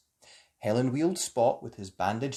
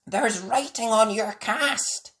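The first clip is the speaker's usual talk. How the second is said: higher, slow puppy voice; puppy noticing the cast; Spot's voice; 8 semitones higher, 4 dB louder, slower than usual. A narrator's reading. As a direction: lift the pitch for Spot. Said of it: "said Spot".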